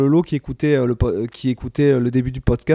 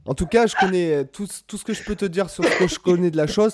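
ordinary delivery

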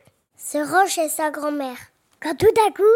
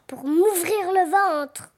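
slow wolf voice; slower than usual. Acted. Wolf answers slowly.

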